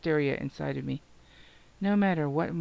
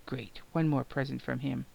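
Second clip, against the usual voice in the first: plain reading voice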